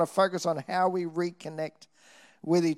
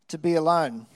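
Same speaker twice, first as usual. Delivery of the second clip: normal talking voice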